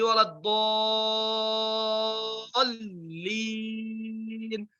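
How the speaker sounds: long chanted waladdallin; Quran recitation. Shown as a demonstration. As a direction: stretch the madd. Recites the verse.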